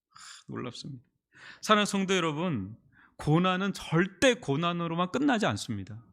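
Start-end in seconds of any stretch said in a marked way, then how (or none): 0.1-1.0 soft, breathy sigh of awe; awed hush. Real, not acted. 성도들에게 회복 소식 전하며, 숨죽인 경탄. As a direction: let the wonder take your breath.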